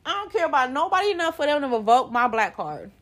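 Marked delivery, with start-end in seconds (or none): 0.0-1.6 voz alta y aguda, desafiante; defiant shout. Real, not acted. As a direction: grita que le da igual.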